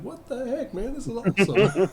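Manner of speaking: put-on high, loud, gleeful old-lady exclamation; excited Scottish grandma. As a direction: squeal it like a thrilled grandma gamer. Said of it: "sounded like a grandma from Scotland".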